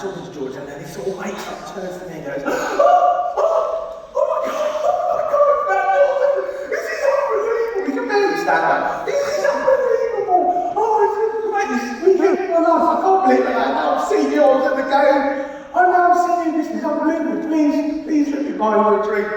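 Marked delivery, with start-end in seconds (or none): 2.5-2.5 put-on far higher, louder, gushing drunk fan; starstruck drunk fan. Imitating overexcited drunk England fan. 2.6-3.3 put-on huge high gasp of a drunk waking; startled gasp. 3.4-19.3 put-on far higher, louder, gushing drunk fan; starstruck drunk fan. Imitating overexcited drunk England fan.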